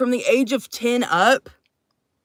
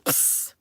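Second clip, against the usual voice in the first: short voiceless "psst" of a flame; imitated torch hiss; 12 dB quieter than usual. Streamer firing a gifted torch, short hissed sound effect. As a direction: hiss like a little blowtorch.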